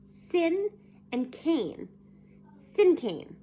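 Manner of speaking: SIN and CANE said slowly, as separate words; careful pronunciation demo. A narrator's reading. Teacher corrects May, carefully sounding out "sin cane". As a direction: sound out "sin cane", each word distinct. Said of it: "The word is pronounced like the words".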